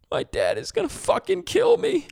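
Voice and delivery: put-on high-pitched panicky teenager voice; scared teenage kid. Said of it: "And he goes".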